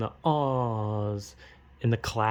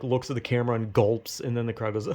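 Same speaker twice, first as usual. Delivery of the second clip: usual speaking voice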